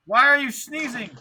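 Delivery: high loud demanding shout. A narrator's reading. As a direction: yell the question. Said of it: "Peely shouted".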